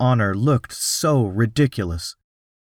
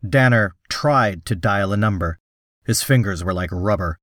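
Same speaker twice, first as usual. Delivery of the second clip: usual speaking voice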